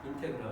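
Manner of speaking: 인테그럴, softly, with "te" and final "reol"; integral as teacher learned it. Shown as a demonstration. Teacher repeating the learned reading, 인테그럴 with "te". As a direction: repeat 인테그럴 low and soft, "eh" after t. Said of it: "인테그럴 이렇게 배웠습니다".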